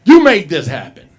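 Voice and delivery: loud, high comic rant; mock-angry telling-off; exasperated scolding. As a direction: scold them loudly, punching each accusation.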